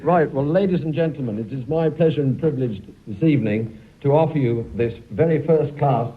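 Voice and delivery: laughing auctioneer selling a filly, pitched high; auctioneer patter. Pretend. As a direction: play the jolly horse-sale auctioneer, giggling through it. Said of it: "David, I'll tell you what we'll do. We'll make her a horse".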